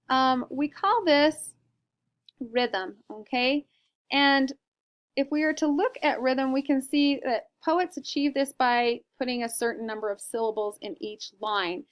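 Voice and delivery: ordinary delivery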